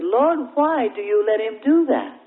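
acted-out troubled appeal; disturbed plea. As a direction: plead in distress for an answer. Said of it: "he became disturbed in his spirit".

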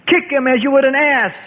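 high, harsh yell; yelling scribe. A narrator's reading. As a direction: spit the order with scorn. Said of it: "yelled a scribe".

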